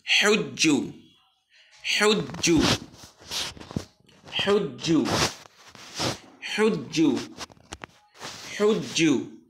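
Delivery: হিজ্জু with a doubled j, chanted; recitation drill. Shown as a demonstration. Teacher leads repetition, clear recitation. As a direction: drill it for the class. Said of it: "তা আমি পড়বো".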